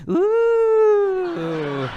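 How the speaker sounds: put-on high, loud whooping ooh of thrilled teens; excited teenagers' ooh. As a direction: whoop like kids on a rollercoaster. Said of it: "teenagers that says".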